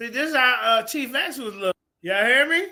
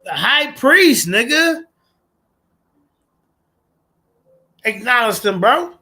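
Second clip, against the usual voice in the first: hyped; 2 semitones higher, 8 dB louder, slower than usual. Real, not acted. Hyped reaction to debate point.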